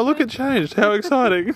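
high sarcastic mock excitement. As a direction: fake excitement over nothing. Mock excitement at silo change.

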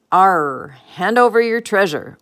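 loud, growled pirate demand; storybook pirate bluster; pirate captain's order. A narrator's reading. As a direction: order them about like a pirate. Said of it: "ordered the pirate captain".

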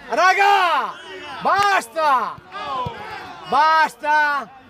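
very loud, drawn-out single-word shouts; exasperated shout. Real, not acted. Player tells his teammates to stop, shouting hard. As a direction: yell at the team to stop.

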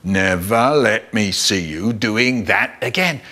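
low, slow stern father; dad telling off. Acted. Dad telling his son off, stern. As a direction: scold with each word set apart. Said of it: "my dad is telling me off".

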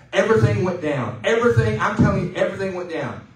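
loud, rising preacher's insistence; preaching emphasis. Real, not acted. Pastor pressing the congregation, loud and insistent. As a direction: drive it home to the congregation. Pastor hammering home a point.

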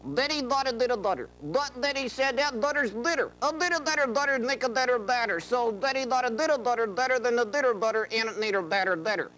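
quick tongue twister without lips moving; fast tongue twister. Shown as a demonstration. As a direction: rattle off the butter rhyme fast. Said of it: "No, like this".

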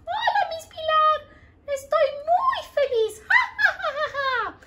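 high, giggly cartoon heart voice; squeaky happy puppet laughing at the class. Heart puppet greets Miss Pilar, high and giggly.

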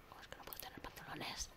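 hushed, breath-only whisper; barely voiced, whispered question; whispered complaint. Real, not acted. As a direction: repeat it as a whisper to yourself. Same question repeated in whisper.

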